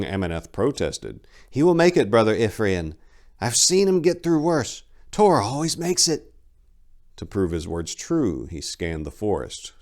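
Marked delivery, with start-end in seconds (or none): 1.5-6.3 put-on higher, louder young voice, insistent; a hopeful youth defending his friend; eager young monk. Young monk protesting to the old brother, insistent and hopeful.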